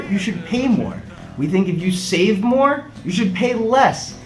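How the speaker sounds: ordinary talk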